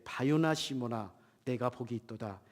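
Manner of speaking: usual speaking voice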